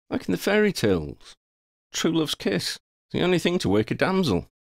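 lightly voiced young hero, pitched high; Harry, teasing. Harry tells Ron only a kiss will wake her, lightly teasing. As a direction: pitch it up as a character explaining.

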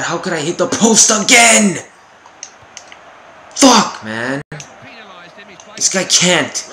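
loud, high outburst of frustration; real angry yell; frustrated shout. Real, not acted. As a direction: yell at the post. Hitting the post again.

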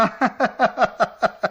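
a sly, knowing laugh; knowing chuckle. Acted. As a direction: chuckle as if you know their secret. Stranger laughs at his own joke.

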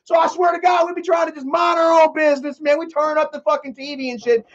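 exasperated neighbor venting about the noisy couple; fed-up neighbor. Retold or imitated. Neighbor voiced complaining about couple.

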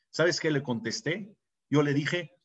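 usual speaking voice

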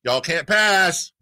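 high, slow Southern wizard; Southern-accent Gandalf. Acted. Radio host replays wizard's line for laughs, hammed-up Southern accent. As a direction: stretch it out in a mock Southern drawl. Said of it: "if he'd had a Southern accent".

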